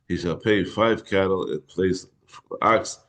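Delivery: ordinary delivery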